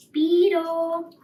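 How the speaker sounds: high loud call of the name. Acted. Sparrow calls out to the sleeping beetle, loud. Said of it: "just as loudly as he had just said who".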